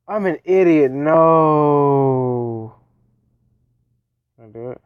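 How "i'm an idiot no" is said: frustrated shout. Real, not acted. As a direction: yell at yourself for messing up. Frustration after a mistake.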